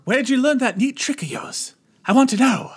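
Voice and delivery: high, hammed-up British voice; put-on film villain. Teasing co-host with the film voice, mock British. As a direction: quote it in a mock British accent. Plays the possessed character's voice.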